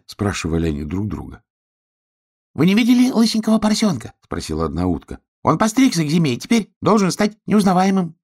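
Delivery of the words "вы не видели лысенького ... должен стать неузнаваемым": little duck voice. Acted.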